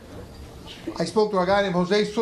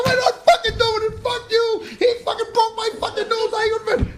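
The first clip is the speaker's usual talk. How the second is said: much higher, louder indignant squeal; hammed-up high-pitched outrage; mock outraged protest; 13 semitones higher, 3 dB louder than usual. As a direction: protest to the judge in a high comic wail. Acted protest to the judge.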